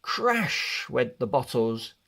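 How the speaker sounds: plain reading voice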